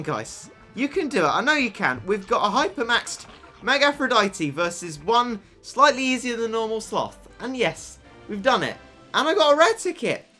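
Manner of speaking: ordinary delivery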